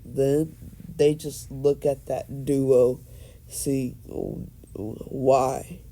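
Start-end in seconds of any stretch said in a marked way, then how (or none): none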